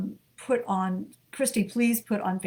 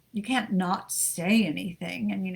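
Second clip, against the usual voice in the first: ordinary talk, nothing marked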